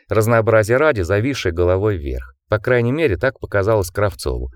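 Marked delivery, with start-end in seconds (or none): none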